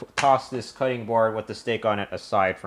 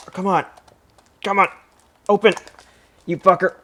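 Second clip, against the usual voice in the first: higher, louder frustrated snaps at a stuck box; 8 semitones higher, 5 dB louder, faster than usual. Real, not acted. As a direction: curse the box that won't open. Fighting a box that won't open.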